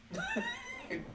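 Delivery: soft high-pitched giggle; quiet, high laugh at one's own jab; amused laugh. Real, not acted. Host after mocking Larry, soft high laugh.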